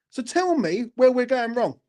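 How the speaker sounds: ordinary talk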